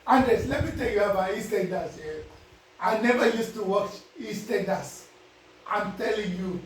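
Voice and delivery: normal talking voice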